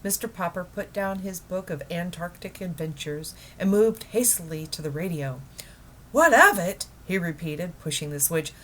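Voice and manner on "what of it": excited. Acted. Repeats his wife's question, excited.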